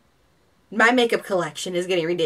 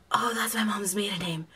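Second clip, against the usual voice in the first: hushed, stunned whisper to herself; whispered disbelief; freaked-out whisper; 5 dB quieter than usual. Retold or imitated. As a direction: breathe it out in shock, no voice. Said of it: "I was freaking out 'cause I was like".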